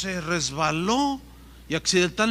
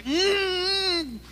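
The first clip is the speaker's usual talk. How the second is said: high crying hum through a mouthful of rope; muffled whimper, mouth closed; 12 semitones higher than usual. Retold or imitated. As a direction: whimper desperately without opening the mouth. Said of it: "no podía decir nada porque". (gemido ahogado con la boca cerrada)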